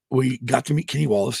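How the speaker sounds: ordinary talk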